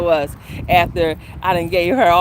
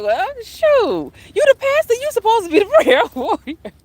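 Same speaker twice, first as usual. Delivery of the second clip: much higher, louder, laughing disbelief; incredulous retort; 12 semitones higher, 4 dB louder than usual. Retold or imitated. Teasing the pastor at the funeral, high and laughing. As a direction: fire it back high and amused. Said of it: "I'm like".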